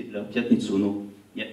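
ordinary talk, nothing marked

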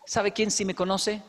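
ordinary talk

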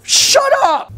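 sudden high yell; angry shout. Acted. Freddy yelling at his cousin to shut up. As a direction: cut him off with a shout.

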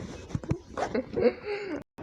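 high giggle. Real, not acted. Kid cracks up mid-take.

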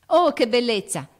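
loud, high delighted cry; excited cry. Acted. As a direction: burst out with joy. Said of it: "gridò Jo".

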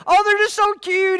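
lightly voiced doting wife; doting mom. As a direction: coo over the kids like a doting mom. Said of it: "my wife would say that".

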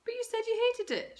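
high, sharp mum voice shooting back; mum's voice, high and accusing; suspicious Mum. A narrator's reading. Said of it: "shot back an increasingly suspicious Mum".

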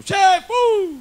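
loud, high shout for the boss; calling the boss. Acted. Barman shouts for the boss from the bar. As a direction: yell toward the back room.

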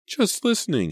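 put-on high, upset little-boy wail; tearful child's protest, pitched high; bawling child. A narrator's reading. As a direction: wail it in self-defence. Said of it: "he bawled".

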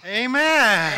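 high, forceful preacher's affirmation; rousing amen. Real, not acted. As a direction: cap the roar with a big amen. Capping the group roar.

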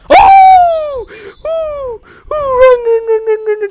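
high panicked yelps, run run; being chased. Real, not acted. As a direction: shriek while fleeing in terror. Screaming fleeing Obunga in game.

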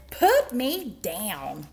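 slow, emphatic demand, fairly loud; storybook rabbit's firm shout; shouted order. A narrator's reading. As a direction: punch each word as the cross rabbit. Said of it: "shouted the rabbit".

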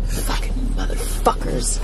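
bitter curse under the breath; whispered curse. Real, not acted. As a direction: hiss it so nobody hears. Cursing the old men privately.

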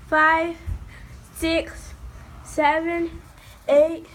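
loud shouted count; barking rep counts. Real, not acted. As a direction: shout each number out. Counting aloud as seeker.

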